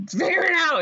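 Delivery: high, hammed-up exasperated order; urgent command. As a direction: toss it out in mock panic. Voicing Jonathan's urgent command to Evelyn.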